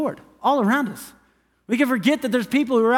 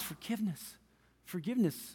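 near-whispered, much softer repeat; hushed forgiveness; 15 dB quieter than usual. Retold or imitated. Pastor voicing Jesus on the cross, barely above a whisper. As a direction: let the word fall almost to nothing.

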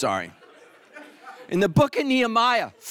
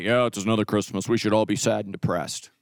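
much lower, glum and droning; mopey holy person; 11 semitones lower than usual. Retold or imitated. Pious churchgoer sighing about Christmas, low glum drone. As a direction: sound like church is a chore. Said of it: "Holy people are like".

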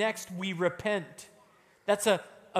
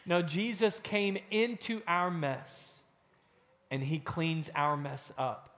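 ordinary talk, nothing marked

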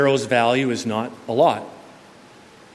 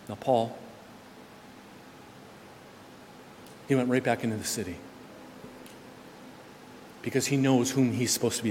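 hushed; 11 dB quieter than usual. Acted. Preacher drops after climax.

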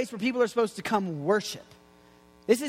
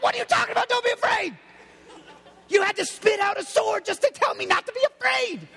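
incredulous outburst; 11 semitones higher than usual. Retold or imitated. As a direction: yell it like you can't believe what you just saw. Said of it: "I'd be like".

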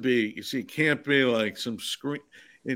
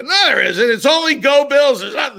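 put-on loud angry drunk shout; 14 semitones higher, 10 dB louder than usual. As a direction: yell it like a belligerent drunk. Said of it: "an angry drunk".

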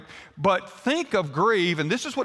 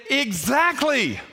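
exasperated; 6 semitones higher, much slower than usual. Retold or imitated. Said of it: "I said".

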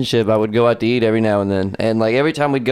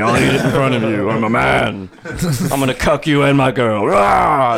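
macho caveman. Pretend. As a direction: brag like a hammy alpha brute. Macho caveman bit continues.

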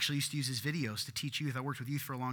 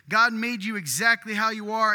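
louder and higher, proclaiming "God" boldly; booming slogan voice; loud proclamation; 5 semitones higher, 9 dB louder than usual. Shown as a demonstration. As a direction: announce it like a bold slogan. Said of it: "I'll say it again".

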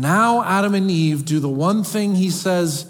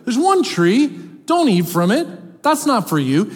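lightly raised voice of a giving God, higher; God speaking; 10 semitones higher, 3 dB louder than usual. Acted. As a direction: offer it like a generous father, lifted up. Preacher voicing God's words.

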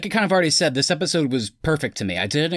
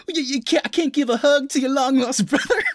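much higher, laughing mock sitcom voice; kooky sitcom brother; 14 semitones higher than usual. Host imitates Pietro as kooky long-lost brother, high and laughing. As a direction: ham up a goofy eighties sitcom loser. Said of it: "whenever he's, like, in, it's like".

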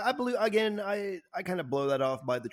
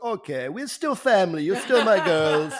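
slower, louder, mock-fatherly reassurance; mock doting dad; 4 semitones higher, 7 dB louder, slower than usual. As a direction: lay on the fake fatherly charm, slowly, half laughing. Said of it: "He's like".

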